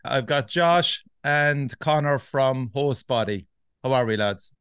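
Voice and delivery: normal talking voice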